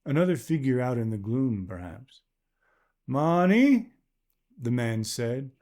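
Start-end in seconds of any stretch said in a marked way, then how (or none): 3.1-3.9 loud call into the dark. Acted. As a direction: shout for the wife nearby. Man calls wife from the gloom.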